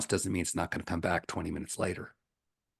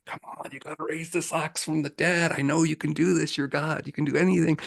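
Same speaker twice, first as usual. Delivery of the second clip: high, louder desperate pleading; higher, pressing plea to God, mild panic; frantic praying; 8 semitones higher, 6 dB louder than usual. Retold or imitated. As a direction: beg God frantically, voice pitched up. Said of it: "he's frantically praying".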